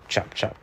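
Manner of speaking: voiceless chop chop like rotor blades; whispered chop sound. Acted. Narrator making Harold's rotor noise, whispered. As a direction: breathe out the chops like a helicopter.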